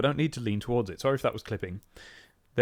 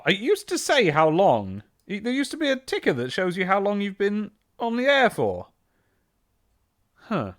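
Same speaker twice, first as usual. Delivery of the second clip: ordinary talk, nothing marked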